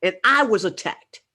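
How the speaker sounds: impassioned. Real, not acted. Impassioned warning to women.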